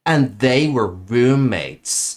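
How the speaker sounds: slow, stretched put-on American accent. Shown as a demonstration. Brit saying it on request for a friend, American accent. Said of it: "Brits typically go Texan with their accents".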